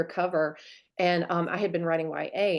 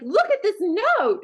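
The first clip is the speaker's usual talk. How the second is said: mock indignant; 13 semitones higher, 5 dB louder, slower than usual. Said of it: "I'd be like".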